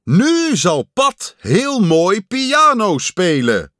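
high, loud, stretched-out announcement; slow showman's call; strange announcer voice. A narrator's reading. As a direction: announce the next act grandly. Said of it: "zei de vreemde stem".

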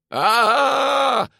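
high-pitched terrified shriek; long scared "aaah" scream; frightened scream. A narrator's reading. Luca hurtling downhill, frightened scream. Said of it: "gilde Luca bang".

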